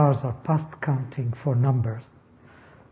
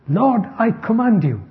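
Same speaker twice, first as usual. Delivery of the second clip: higher-pitched mock demanding prayer; commanding prayer; 6 semitones higher than usual. People praying too literally to the Lord, imitated commanding tone. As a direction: imitate someone ordering God about in prayer. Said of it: "I've heard people praying".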